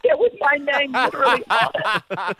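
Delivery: high mock-horrified reaction through laughter; laughing mock horror; retold shock. Retelling own shock at signed axe.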